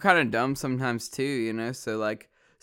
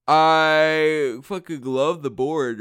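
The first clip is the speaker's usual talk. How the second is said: excited; 4 semitones higher, 9 dB louder, much slower than usual. Real, not acted. Excited declaration about the board.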